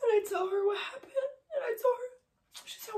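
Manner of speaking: high, tearful voice breaking down; real crying. Real, not acted. Wife recalls telling husband's mom what happened, crying. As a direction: struggle to get the words out through sobs.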